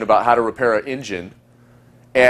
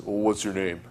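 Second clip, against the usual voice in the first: bored question; 3 semitones lower, 11 dB quieter than usual. Shown as a demonstration. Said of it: "But notice".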